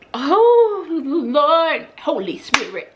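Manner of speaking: high cry of oh, then pleading to the Holy Spirit; half-joking plea to heaven; mock prayer. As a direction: stylist praying for a tip. Calling on God about the tip.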